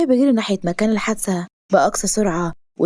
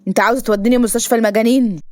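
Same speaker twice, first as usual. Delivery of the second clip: usual speaking voice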